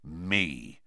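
low, soft grim reply. A narrator's reading. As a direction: answer grimly and flatly. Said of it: "said Moody grimly".

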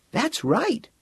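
put-on high little boy's voice; murmuring boy. A narrator's reading. Boy answering Eng's appeal, a high childlike murmur. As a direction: pitch it well up, a child's absent reply. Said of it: "the boy murmured".

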